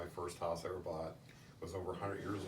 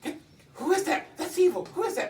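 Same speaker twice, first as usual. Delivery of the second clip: much higher and louder frantic question; terrified high cry of who is that; aunt's frightened demand; 13 dB louder than usual. Retold or imitated. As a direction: shriek at the stranger in the photo. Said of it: "She says".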